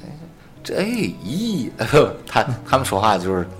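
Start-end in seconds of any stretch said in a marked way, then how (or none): 0.0-1.7 put-on Shaanxi accent.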